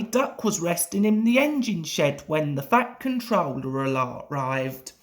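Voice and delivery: ordinary delivery, nothing marked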